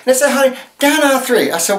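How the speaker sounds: high, sassy hostess voice pitching a product; sassy infomercial host JJ. Acted. Host to viewers, sassy high-pitched pitch. Plays drag character JJ hosting infomercial.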